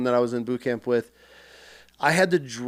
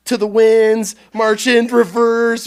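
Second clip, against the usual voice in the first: raised, loud command calls; mimicked drill commands; 6 semitones higher, 6 dB louder, slower than usual. Drill instructor drilling recruit with trash can, imitated loud commands. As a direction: call out drill orders like an instructor. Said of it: "calling off all the rifle ... commands for drill".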